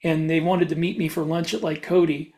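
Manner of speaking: usual speaking voice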